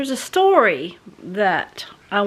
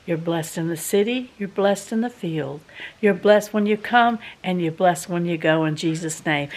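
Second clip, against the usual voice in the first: blessing recitation; 4 semitones lower than usual. Acted.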